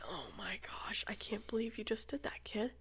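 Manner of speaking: soft, unvoiced disbelief; whispered disgust at the toddler. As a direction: whisper to the kid, appalled.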